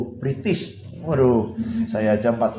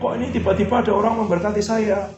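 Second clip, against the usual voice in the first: amazed question; 9 semitones higher than usual. Retold or imitated. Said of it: "bilang ini".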